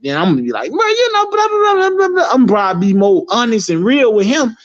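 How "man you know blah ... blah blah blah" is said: squeaky high mock chatter. High squeaky blah blah to the weed seller. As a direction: toss off high-pitched blah blah. Mocking talk to a hustler.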